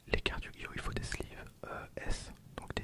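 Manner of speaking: ordinary talk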